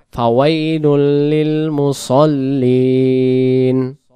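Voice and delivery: very slow stretched tajweed chant; slow melodic verse recitation; Quran recitation. Shown as a demonstration. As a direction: draw out the nasal ending melodically.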